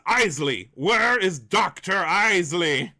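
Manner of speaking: high, loud, drawn-out shout; villain's shouted demand. Acted. Villain demands the doctor from heroes, shouted and drawn-out. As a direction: holler it as a theatrical villain. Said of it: "but not like that".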